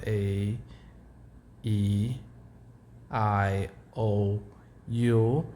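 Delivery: the vowels spelled out the English way; English vowel names. Shown as a demonstration. The teacher lists the English vowel names. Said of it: "but in Portuguese we say".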